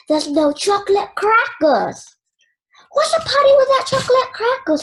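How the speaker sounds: rising to a high, loud, fast cry of dismay; dismayed outburst. A narrator's reading. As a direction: panic at missing party treats. Girl's panicked thought.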